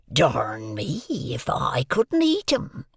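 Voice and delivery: slow, hoarse menacing whisper; threatening convict whisper. A narrator's reading. Convict threatening to eat the boy, slow menacing whisper. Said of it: "with a threatening shake of his head".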